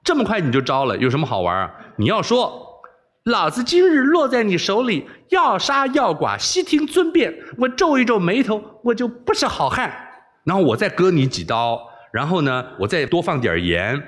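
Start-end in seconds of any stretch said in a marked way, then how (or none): 0.0-9.9 put-on high teasing girl's voice, scolding playfully; mock him like a spoiled girl; giggling mockery. Princess taunts her captive for giving in, high teasing voice. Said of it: "嘻嘻一笑说".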